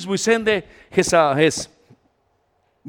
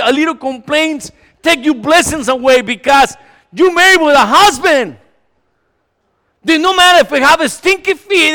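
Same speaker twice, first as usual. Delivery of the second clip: loud, higher sermon push; fervent preaching; 4 semitones higher, 10 dB louder than usual. Acted. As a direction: drive the message at the congregation. Preaching at full force.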